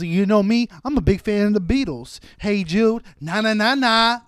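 high, loud, rambling meth-head voice; crackhead rambling. Retold or imitated. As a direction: preach nonsense like a wired street guy. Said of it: "crackhead talk".

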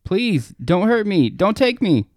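high, loud desperate begging; terrified pleading. Acted. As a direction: plead for your life, screaming. Begging the creature.

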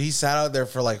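ordinary talk

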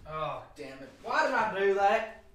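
usual speaking voice

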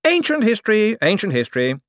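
high, hasty dismissal, said twice; quick brush-off. A narrator's reading. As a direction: wave the subject away fast. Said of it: "said Hicks quickly".

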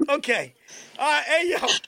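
ordinary talk

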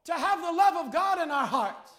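loud preacher's plea to God; fervent prayer. Real, not acted. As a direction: cry out the prayer at full force. Cries out a prayer in sermon.

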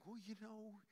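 soft, sly coaxing tempter; the devil coddling you, soft and sly. Acted. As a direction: stroke their ego softly. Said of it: "He loves to coddle you and saying".

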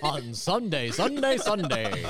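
stretched, sing-song Sunday; drawing out Sunday, Sunday; drawn-out Sunday. Pretend.

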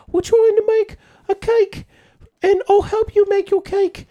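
high, airy mock-British helpful voice; sweet British baker. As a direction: chirp it like a kindly British baker. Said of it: "all the British people are like".

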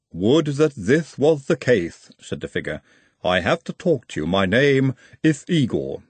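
measured, formal Igor voice with a foreign lilt. Acted. Igor insists on talking.

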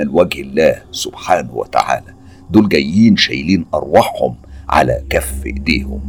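low, soft, calming counsel; advising. A narrator's reading. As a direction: gently advise a younger man to stay composed. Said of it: "وكأنه بينصحني". (نصيحة بهدوء وصوت واطي)

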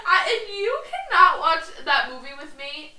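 half-laughing outraged shout; laughing rant. Real, not acted. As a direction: vent it while laughing. Ranting laughing.

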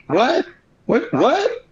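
rising, repeated questions after a stunned pause; retold shock. Guest relives hearing relatives are dead, shocked and rising.

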